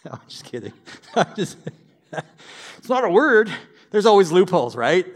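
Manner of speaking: laughing loudly at own joke. Real, not acted. Pastor joking about traffic, real laughter. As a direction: crack up at the horn gag.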